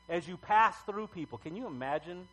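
usual speaking voice

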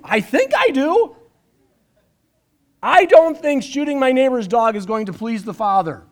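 ordinary talk

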